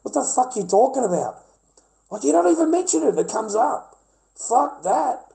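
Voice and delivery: high, loud exasperated yelling; indignant outburst. Acted. Mocking the boyfriend who never mentions it, high and loud. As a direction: scoff at him in disbelief. Ranting at the man in story.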